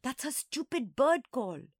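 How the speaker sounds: high, slow irritation; annoyed, drawn-out and high; hissed. A narrator's reading. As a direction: hiss it in exasperation. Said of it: "Monkey hissed".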